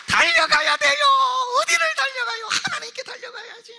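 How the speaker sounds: high, loud exhortation; rally the congregation; shouting call to run to God; fervent shout. Real, not acted.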